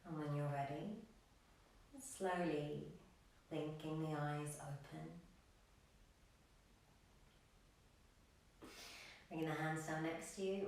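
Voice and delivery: soft, low-key yoga meditation voice with long pauses; guided meditation. Acted. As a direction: gently guide the class into stillness, leaving room to breathe. Leads a breathing meditation.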